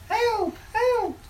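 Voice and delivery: very high distant screams for help; calling for help. Acted. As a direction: cry out for help in panic. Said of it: "voice saying".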